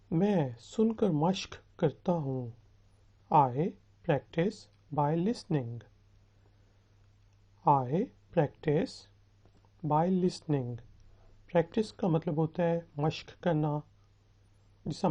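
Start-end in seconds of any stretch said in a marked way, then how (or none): 3.3-10.8 very slow, phrase by phrase; slow model sentence. Shown as a demonstration. Teacher modelling a sentence for students, slow and deliberate. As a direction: space out the words for repeating.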